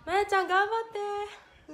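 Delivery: 高めの大きな声で応援; 語尾を伸ばして元気に呼びかける. Real, not acted. 配信から去る仲間に声援. (大きな声で応援)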